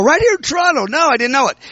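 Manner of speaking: high, startled disbelief; amazed he is right here; surprised. Retold or imitated. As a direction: caught off guard, voice jumps up. Surprised Benny Hinn is in town.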